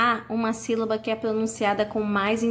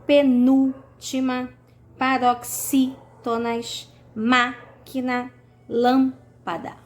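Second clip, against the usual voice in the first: "Penúltima", "paroxítonas", "máquina", "lâmpada" lentas, com a antepenúltima forte e alongada; antepenúltima sílaba forte; 3 semitones higher, 3 dB louder, much slower than usual. Shown as a demonstration. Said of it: "antepenúltima sílaba é a mais forte". (antepenúltima tônica em "Penúltima", "paroxítonas", "máquina", "lâmpada")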